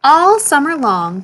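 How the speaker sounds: ordinary delivery, nothing marked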